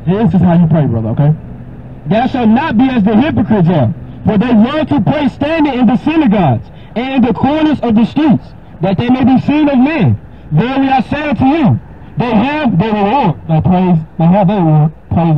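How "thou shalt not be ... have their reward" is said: raised, solemn King James reading; scripture reading. Acted. Preacher reading Matthew six to a caller, solemn. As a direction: read it like holy writ.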